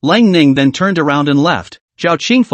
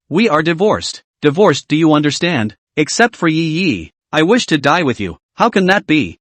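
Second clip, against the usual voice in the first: usual speaking voice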